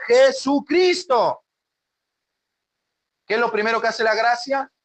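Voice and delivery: high, loud fiery preaching; preaching at full force. Acted. Pastor preaching to the congregation, fiery and loud. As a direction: thunder the scripture to the flock.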